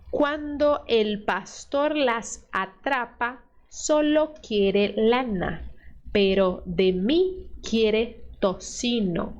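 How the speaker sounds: slow, clear reading of the story line; slow model reading. Shown as a demonstration. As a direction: read out slowly and clearly. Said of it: "Vamos ver".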